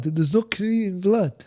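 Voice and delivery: ordinary talk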